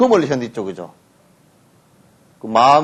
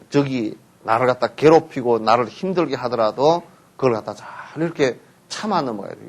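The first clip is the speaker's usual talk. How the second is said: ordinary delivery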